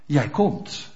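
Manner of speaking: normal talking voice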